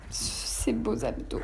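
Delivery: souffle murmuré, ralenti; lâcher les mots tout bas en tenant l'effort; chuchoté. Real, not acted. Murmure pendant la planche. (murmure essoufflé)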